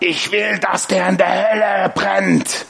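slow, drawn-out quote with stretched words; imitated vengeful declaration. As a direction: imitate her, drag out every word with deliberate venom. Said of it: "Richtig so".

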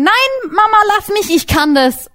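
mock kid whining at mum; stubborn little child. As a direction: play the toddler who insists on doing it alone. Child insisting to mother.

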